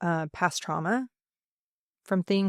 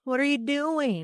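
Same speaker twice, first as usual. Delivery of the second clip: high, exasperated disbelief; incredulous; 9 semitones higher than usual. Retold or imitated. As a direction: ask it in shocked disbelief. Teacher reacting to discouraging parents.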